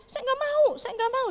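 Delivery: put-on very high pleading child voice; child refusing. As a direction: whine refusal like a scared kid. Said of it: "dia bilang". (anak merengek menolak, suara sangat tinggi)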